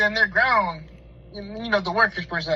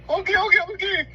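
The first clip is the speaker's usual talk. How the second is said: high, panicky okays; mock terrified pleading under a gun; frightened worker caving in, high and panicky; scared; 11 semitones higher, 4 dB louder, much faster than usual.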